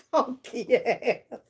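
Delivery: ordinary delivery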